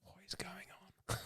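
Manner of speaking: whispered, breathy wonder; hushed whisper. Retold or imitated. Asking the eternal question in a hushed whisper. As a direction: whisper it like a secret. Pondering the big question aloud.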